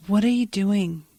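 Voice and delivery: usual speaking voice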